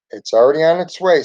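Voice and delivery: ordinary delivery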